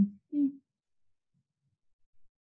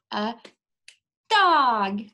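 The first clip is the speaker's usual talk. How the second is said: excited reveal; 2 semitones higher, 6 dB louder than usual. Acted. Reveal to children.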